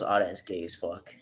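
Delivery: ordinary talk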